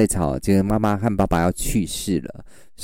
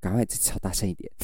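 inner whisper; 7 dB quieter, faster than usual. Pretend. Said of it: "心里想说".

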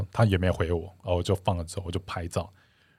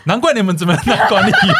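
mocking inner voice; 11 semitones higher, 8 dB louder than usual. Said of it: "一样的O S就说".

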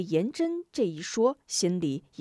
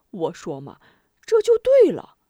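smug and mysterious; 3 semitones higher, 8 dB louder than usual. A narrator's reading. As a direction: play the sly schemer. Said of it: "又得意又神秘地说道". (神秘得意地说)

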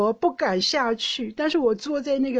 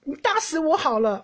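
melodramatic plea; 7 semitones higher than usual. Retold or imitated. Imagined TV mom begging husband.

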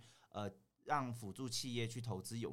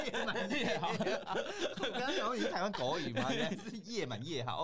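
laughing; 10 semitones higher, 3 dB louder than usual. Real, not acted. Laughing at misheard phrase.

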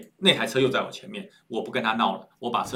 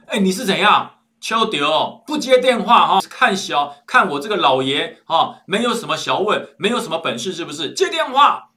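loud, high, bullying bark; a domineering boss yelling down the phone; fierce phone voice; 6 semitones higher, 9 dB louder than usual. Retold or imitated. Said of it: "那边传来一个非常凶悍的声音". (电话里凶悍的老爷大声吼骂)

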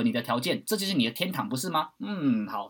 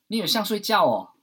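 scoffing mock question, pitched up and louder; 7 semitones higher, 6 dB louder than usual. Mocking the suicidal friend's belief, scoffing. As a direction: ridicule the idea that death is like sleep. Mocking a naive view of death.

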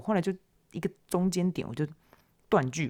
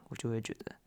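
normal talking voice

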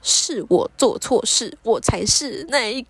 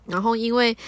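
ordinary talk, nothing marked

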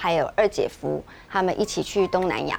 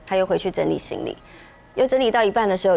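ordinary delivery

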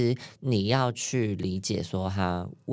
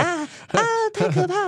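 hammed-up scream, much higher, louder; mock terror; 4 dB louder than usual. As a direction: shriek it like it's a horror film. Mock panic about disease fears. (夸张的惊恐尖叫)

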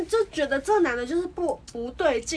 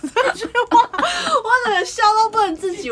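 real laughter breaking through every word, louder and higher; laughing helplessly; 7 semitones higher, 7 dB louder than usual. Real, not acted. Recalling her crying friend, laughing uncontrollably. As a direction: talk while cracking up.

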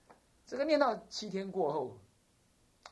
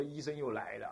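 usual speaking voice

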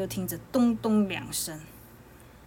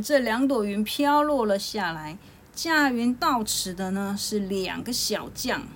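plain reading voice

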